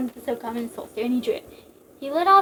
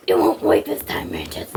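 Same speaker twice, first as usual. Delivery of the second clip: through gritted teeth; 4 dB louder than usual. Acted. Snake defying Mantis while in pain, gritted teeth. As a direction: force it out through clenched teeth. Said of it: "Snake said through gritted teeth".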